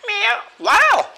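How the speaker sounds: high, loud cat meows and goat bleats; animal noises. Shown as a demonstration. Imitating cat and goat sounds.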